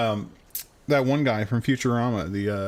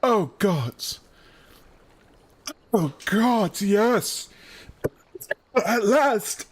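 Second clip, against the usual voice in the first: hammed-up relief; 7 semitones higher, much slower than usual. Pompous character moaning while being oiled, hammed-up. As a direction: overdo the pleasure of the massage. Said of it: "I can't do the voice".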